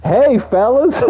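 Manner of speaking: high bright mock greeting; cheery greeting. To the neighbours, a high mock hello. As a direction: call it out all friendly.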